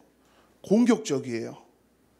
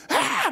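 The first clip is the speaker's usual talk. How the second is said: imitated child yell; 14 semitones higher than usual. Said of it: "맨날 '아!' 막 하고".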